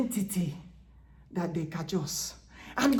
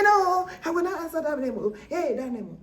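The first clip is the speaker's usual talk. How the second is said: high, louder and fast defiant refusal; fiercer refusal; 10 semitones higher, 8 dB louder, faster than usual. Retold or imitated. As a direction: spit the refusal out quicker and louder. Same refusal, acted harder.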